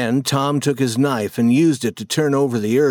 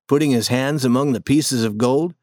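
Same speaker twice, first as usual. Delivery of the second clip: usual speaking voice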